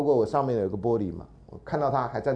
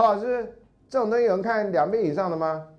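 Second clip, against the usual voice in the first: incredulous student; 9 semitones higher than usual. Retold or imitated.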